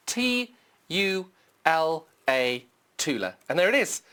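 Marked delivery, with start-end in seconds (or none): 0.1-2.6 letters spelled slowly one by one. Shown as a demonstration. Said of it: "just type in Tula".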